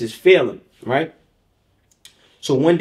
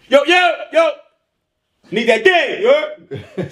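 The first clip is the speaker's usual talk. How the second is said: hyped street greeting; 10 semitones higher than usual. Retold or imitated. Aliko greeting Gito, high and hyped up. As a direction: greet your boy hyped.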